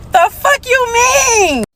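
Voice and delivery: high, drawn-out incredulous cry; acted-out indignant squawk at a husband; outraged disbelief. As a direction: let the inner outrage fly up high, stretched out. Inner outraged reply to husband.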